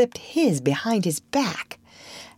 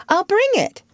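put-on much higher, bright eager girl; 5 dB louder than usual. A narrator's reading. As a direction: jump up eager to help. Wendy eager to fetch medicine.

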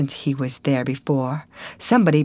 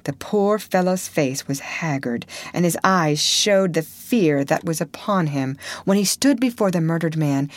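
ordinary delivery, nothing marked

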